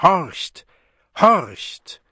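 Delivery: loud, high, sudden call; trumpeting command. A narrator's reading. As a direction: trumpet each word out as a summons. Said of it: "trompetete die Stimme".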